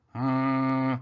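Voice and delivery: long droning hum on one note; robotic snore. A narrator's reading. Robot falls asleep on the blanket, droning snore. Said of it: "snored Rab-, Robot".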